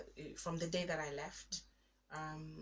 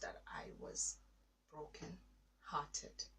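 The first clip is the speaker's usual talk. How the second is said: hushed; 4 semitones lower, 9 dB quieter, much slower than usual. Real, not acted. Quiet realization of heartbreak.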